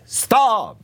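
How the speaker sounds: high, drawn-out cry of stop; imitating a screamed order; mock scream. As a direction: cut everyone off with a high yell. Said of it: "The guy screamed".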